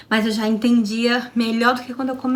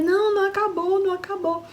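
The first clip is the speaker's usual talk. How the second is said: wife contradicting husband, high and tearful, fading; tearful insistence; 9 semitones higher than usual. Retold or imitated. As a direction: insist through tears.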